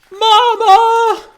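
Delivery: put-on very high, loud baby bird call; gosling cry. A newborn gosling crying out for its mama. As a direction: squeal it like a hatchling.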